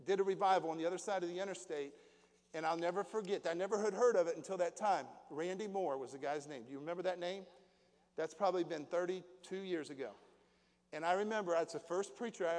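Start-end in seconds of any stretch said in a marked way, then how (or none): none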